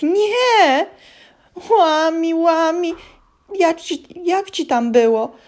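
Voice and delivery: high, loud stammering elf; frightened elf fumbles the giant's name; panicked squeal. A narrator's reading.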